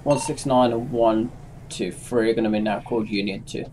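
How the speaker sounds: ordinary delivery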